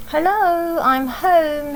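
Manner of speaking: daddy calling hello as he comes in. Acted. Sophie's daddy arriving home from work, calling out cheerfully. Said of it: "he called".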